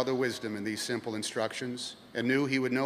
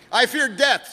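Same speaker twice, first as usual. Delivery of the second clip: higher, louder fearful pilgrim; frightened pilgrim; 8 semitones higher, 7 dB louder than usual. Acted. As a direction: cry it out in fear. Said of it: "said the pilgrim".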